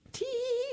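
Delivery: very high little titter; squeaky-high storybook tee-hee; giggling. A narrator's reading. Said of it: "she laughed".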